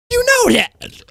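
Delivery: way up high, drawn out and insistent; pointed emphasis. Real, not acted. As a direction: shoot the pitch up to hammer it home. Pressing the point to chat.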